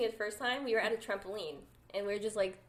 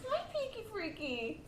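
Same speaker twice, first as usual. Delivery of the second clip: cooing pet talk; 11 semitones higher, 5 dB quieter, slower than usual. Real, not acted. Greeting the pet who slept through the show, cooing. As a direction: coo slowly to a sleepy pet.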